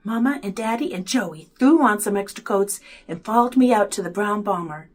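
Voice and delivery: plain reading voice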